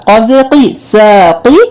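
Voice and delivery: ordinary delivery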